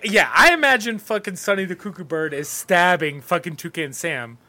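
usual speaking voice

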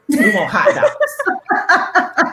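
put-on high, very slow laughing child voice; children demanding hot dogs, slow and laughing; kid demanding. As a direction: drag it out like a whiny kid.

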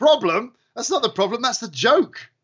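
high-pitched mock disbelief; incredulous retort. Host retelling his reply to a commenter, incredulous. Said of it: "I was like".

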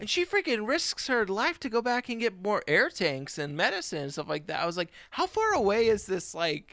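loud, high-pitched incredulous outburst; incredulous rant. Real, not acted. Cohost marvels at her risking her life, loudly incredulous. Animated rant about movie's implausibility.

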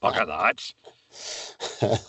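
lightly acted character quoting a nag, then grumbling; Brian Scott. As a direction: play a put-upon detective sergeant. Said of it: "D S Scott".

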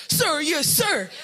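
high, loud drill-style shout; military yell. Retold or imitated. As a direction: imitate a military man screaming orders. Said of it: "he'd come and he'd scream at us".

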